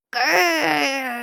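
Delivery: playful cartoon growl; hammed-up kitten growl; mock growl. A narrator's reading. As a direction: growl like a small scary spider.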